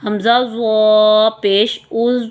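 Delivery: ز in زو drawn long and full; teacher drilling زو with a full heavy sound; slow spelling drill. Shown as a demonstration. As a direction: spell it slowly, letter by letter. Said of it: "تو کو پر کرتے ہوئے".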